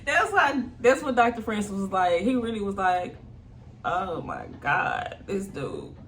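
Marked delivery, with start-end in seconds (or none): 3.8-5.9 low, slow mock exasperation; eye-rolling exasperation. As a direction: drag it out like an imitated eye roll. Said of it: "rolling his eyes".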